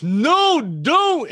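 high, loud, drawn-out shout; hammed-up warning cry; retold alarmed call. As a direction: imitate a friend yelling to stop. Retells Luis calling out to stop.

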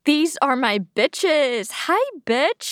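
put-on high, teasing call to a close girlfriend; playful friend greeting. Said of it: "คือเขาจะพูดว่า". (ทักเพื่อนสาวแบบแซวๆ เสียงสูง)

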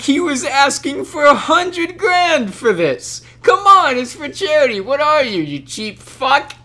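high, slow exasperated yelling; Seth Rogen yelling. Retold or imitated. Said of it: "He's like".

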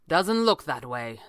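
ordinary delivery, nothing marked

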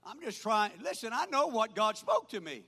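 ordinary delivery

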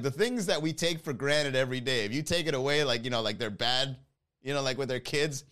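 ordinary delivery

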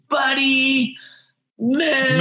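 broad, exaggerated Australian accent, pitched high; super Australian. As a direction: ham up the Australian accent to introduce himself. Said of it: "I would just talk super Australian".

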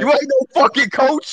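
high, slow, drawn-out jeer; heckling outburst. Real, not acted. Listener repeating his verdict on the would-be coach, slow high heckling.

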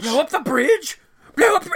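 high, loud repeated outrage; shocked disbelief. Retold or imitated. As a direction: Nicholson refusing to believe it. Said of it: "He's like".

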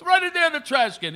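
high voice of a helpful pizza guy. Retold or imitated. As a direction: point the way, lightly voiced. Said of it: "And the guy goes".

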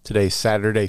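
ordinary delivery

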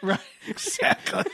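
laughing high agreement. Real, not acted. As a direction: laugh through the words.

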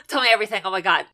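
titillated. Real, not acted. Excited about new horror show.

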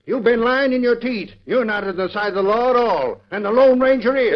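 furious realization that he's been tricked; angry accusation. Acted. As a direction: throw the accusation in his face. Telegrapher angrily accuses Dorneman.

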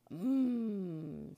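long, loud contented hum; booming hum of delight; happy giant "mmm". A narrator's reading. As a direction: giant savoring a treat with a big "mmm". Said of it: "said the giant happily".

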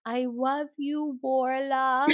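put-on toddler's sweet mispronunciation; imitating a toddler. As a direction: say it like a little kid mangling Laura. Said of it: "And she goes".

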